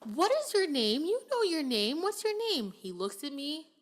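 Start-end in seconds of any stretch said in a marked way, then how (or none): 0.1-2.7 higher and louder, pleading; sing-song coaxing the child; coaxing teacher. Retold or imitated. Teacher urging a boy to say his own name, rising and coaxing.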